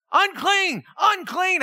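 calling out warning. Retold or imitated. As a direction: call out like a leper warning people. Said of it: "they're supposed to be screaming".

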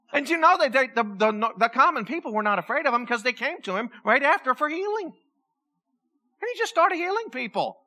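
ordinary delivery

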